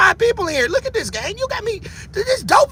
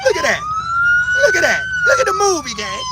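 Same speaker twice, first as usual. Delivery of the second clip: squeaky, much higher mock voice; mocking falsetto. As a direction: squeal it out to ridicule them. Mocking the people in the video.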